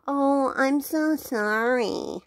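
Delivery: hammed-up insincere apology, lingering on "so"; drawn-out fake apology. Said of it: "after faking an apology".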